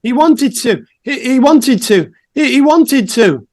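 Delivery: high, stammering frightened answers; cowed stammering. Acted. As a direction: stammer it out like a terrified suspect. Interrogated suspect stammers answers.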